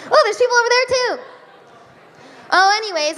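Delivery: ordinary delivery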